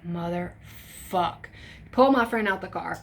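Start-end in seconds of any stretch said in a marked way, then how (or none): 0.1-1.5 slow, soft dragged-out swear; drawn-out curse. Retold or imitated. Teen drags out a curse when ordered out of the truck. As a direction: stretch it out in defeat. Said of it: "And I said".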